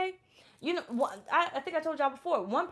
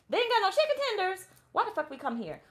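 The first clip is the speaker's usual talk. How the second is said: indignant surprise; 8 semitones higher than usual. Retold or imitated. Menu without chicken tenders.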